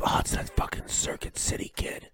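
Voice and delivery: a silent mouthed aside; mouthed whisper. Retold or imitated. Wrestler mouthing to his wife, whispered. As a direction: whisper it, barely a breath.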